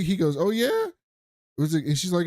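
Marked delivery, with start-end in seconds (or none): 0.4-0.9 surprised oh yeah. Retold or imitated. Ron surprised by YouTube news.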